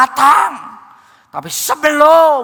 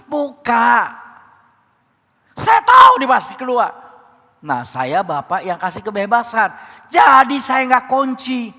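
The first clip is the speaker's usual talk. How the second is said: ordinary talk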